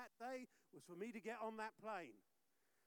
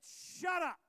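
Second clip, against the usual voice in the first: louder, higher snapped order; stern command; 8 semitones higher, 12 dB louder than usual. Retold or imitated. Pastor retelling how he silenced his friend, stern loud shut up. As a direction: bark it to end the panic.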